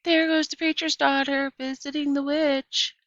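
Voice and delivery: high, loud teasing holler; sing-song schoolyard jeer; kids hollering taunt. Acted. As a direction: taunt her across the street like a kid. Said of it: "They would holler".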